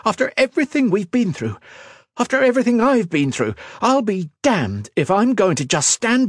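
high-pitched, mounting outrage; angry snap. A narrator's reading. Woman refuses to let a rival ruin her life, snapping. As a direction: rant with indignant fury. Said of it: "she snapped".

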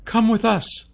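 high, urgent female character voice; blurted out. A narrator's reading. Sasha pleads with the cursed king, high blurted plea. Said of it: "Sasha blurted out".

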